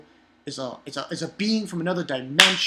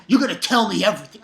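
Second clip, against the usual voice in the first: loud, rushed mock demand; bully someone for answers, fast and loud; 3 semitones higher, 6 dB louder, much faster than usual. [name] holding Aladdin by the shirt, mock demanding.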